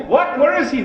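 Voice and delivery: loud, high, astonished calls; startled crowd. Retold or imitated. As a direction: crowd demanding where the son is, loud and amazed.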